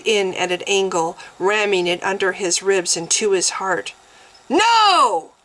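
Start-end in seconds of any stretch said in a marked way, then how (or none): 4.5-5.3 high, loud, otherworldly cry; a deity roaring in anguish; god's cry. A narrator's reading. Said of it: "Malian's cry".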